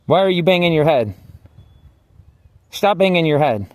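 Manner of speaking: a guard demanding he stop; female guard scolding. Retold or imitated. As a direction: scold him like a jail guard. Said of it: "this female guard say".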